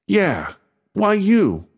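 high, slow, drawn-out doubting ask; suspicious. A narrator's reading. Maz asking again why Beto, slow and suspicious. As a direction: lean on you with suspicion.

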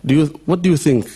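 ordinary talk, nothing marked

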